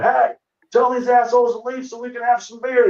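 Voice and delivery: put-on old bar regular yelling at the cops to let him drink; mock barfly yell. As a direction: holler like a grumpy regular wanting beer. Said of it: "they're yelling at the local cops".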